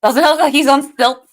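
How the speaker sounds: half-laughing question; teasing friend, loud and amused; amused disbelief. Real, not acted. Joking at the tripod image.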